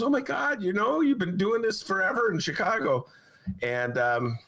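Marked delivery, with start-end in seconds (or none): 0.0-3.1 high-pitched retold astonishment; imitated amazement. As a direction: play her amazed reaction, pitched up. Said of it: "She goes".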